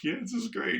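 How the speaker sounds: high, bright, overexcited fan voice; giddy fan. Retold or imitated. Fan posing beside his idol, high excited gush.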